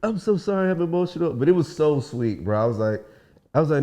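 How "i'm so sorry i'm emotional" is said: high-pitched, mock weepy voice; retold emotional, high apology; imitated tearful apology. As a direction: play her overcome, pitched up. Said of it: "she started crying".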